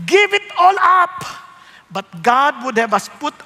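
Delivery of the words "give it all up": slow, higher, louder tempting voice; tempter's voice. Acted. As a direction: coax like a tempter. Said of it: "evil advisors come". (mabagal at mapang-akit na boses)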